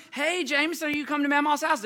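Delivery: put-on high, loud greeting to a young child; match a kid's energy on the phone; excited uncle.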